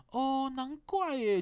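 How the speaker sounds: slow, drawn-out, high and loud oh-I-see; mimicking the masseur. Imitating the masseur's remark, slow and high. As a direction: stretch it out like a know-it-all. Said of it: "他就想说".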